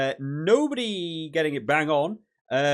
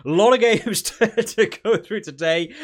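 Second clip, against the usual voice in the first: laughing; 6 semitones higher, 8 dB louder than usual. Real, not acted. Laughs at the many games.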